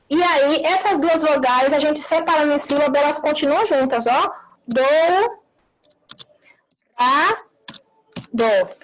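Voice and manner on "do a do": "Do, a, do" with a pause between each syllable. Shown as a demonstration. Teacher spelling out syllables for the class, slow and separated. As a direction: split do-u-ra-do slowly into syllables. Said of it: "aqui eu encontro vocálico de ditongo".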